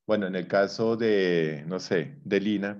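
normal talking voice

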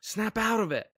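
high-pitched acted urging; mock shaking a friend out of a daze; urgent wake-up call. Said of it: "taking a friend and shaking him".

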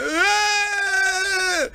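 very high mock scream; imitating a panicked yell; retold scream. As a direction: yell like you're fleeing a bird.

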